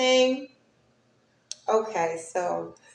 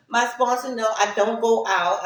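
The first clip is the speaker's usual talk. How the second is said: ordinary delivery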